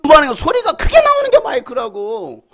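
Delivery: loud and high, slowing on the last line; preacher's shout; shouting the point. Real, not acted. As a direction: drive it home at full voice.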